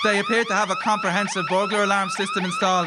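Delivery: a Dublin thief sizing up the house; Dublin accent. Acted. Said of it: "big accents on us".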